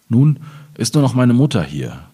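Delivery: normal talking voice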